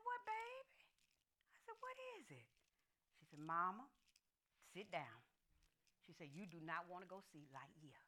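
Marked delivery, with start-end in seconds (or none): 0.0-2.5 high, soft anxious question; hushed concern. Retold or imitated. Mother answering worried quiet daughter. 3.4-8.0 low, hushed, grave daughter; quiet. Retold or imitated. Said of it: "they was quiet".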